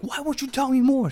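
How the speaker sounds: high, loud whiny plea; pleading viewer. Retold or imitated. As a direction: beg like a curious viewer. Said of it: "enough intrigue to be like".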